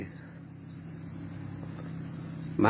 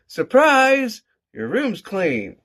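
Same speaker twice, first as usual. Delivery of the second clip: teasing mom reveal; 13 semitones higher, 5 dB louder, much slower than usual. Retold or imitated. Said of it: "And Mom was like".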